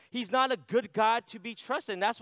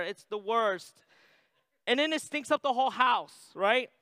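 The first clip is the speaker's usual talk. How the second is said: ordinary delivery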